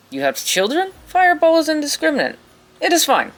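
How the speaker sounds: high-pitched, hammed-up Russian accent; cartoonish Russian accent, pitched high; bad Russian accent. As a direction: play a dismissive elf wizard with a fake Russian accent. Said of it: "she has a very bad Russian accent".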